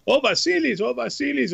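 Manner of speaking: high-pitched mock pleading cry; imitated crowd screaming. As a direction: imitate worked-up fans calling out. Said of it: "they're screaming".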